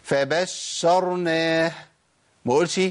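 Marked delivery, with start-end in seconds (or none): 0.0-1.7 ش in فبشرناه stretched very long; exaggerated long ش in فبشرناه; overlong shin. Shown as a demonstration. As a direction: drag out the double sh of فبشرناه. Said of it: "ده كتير قوي كده".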